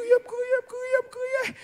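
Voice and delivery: high, soft mock wail; hushed, high-pitched retold weeping; imitated crying. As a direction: wail it thinly, acting out tears. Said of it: "me crier, bien aimé".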